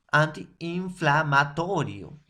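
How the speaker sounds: "antiinflamatorio" slowed, with the double I lengthened; teacher slows "antiinflamatorio" to show the double I; slowed demo. Shown as a demonstration. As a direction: stretch the two Is in "antiinflamatorio". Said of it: "En este caso".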